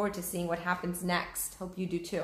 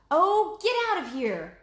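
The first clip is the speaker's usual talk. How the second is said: much higher, louder angry yell; shouted; 10 semitones higher, 7 dB louder than usual. Acted. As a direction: chase off the bird with a shout. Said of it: "Annie shouted at the vulture".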